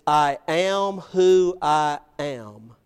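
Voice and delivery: very slow, weighty "I am who I am"; solemn declaration. Acted. God naming himself to Moses, solemn and slow. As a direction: draw out each word with authority.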